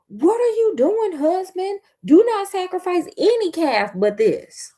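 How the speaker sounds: high, urgent outcry; crying out. A narrator's reading. Hater wife begging her husband over the calf, crying out. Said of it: "she cried out".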